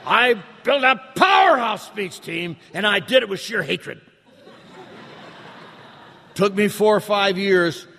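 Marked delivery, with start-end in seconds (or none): 0.0-4.0 triumphant shout. Real, not acted. Bragging to the audience about his speech team, shouted. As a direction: bellow it like a victory.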